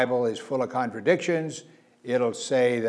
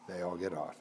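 repeated much softer and lower; hushed, lower insistence; quiet emphatic repeat; 6 semitones lower, 12 dB quieter, faster than usual. Shown as a demonstration. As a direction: drop it right down to drive it home.

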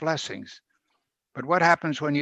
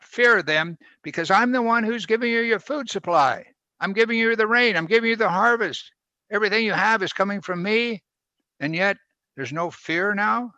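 voiced higher as God declaring his gifts; God speaking; 7 semitones higher than usual. Preacher voicing God telling Judah he gives the rain, raised and proclaiming. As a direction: lift the pitch and proclaim as the Lord. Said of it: "The God is telling them that".

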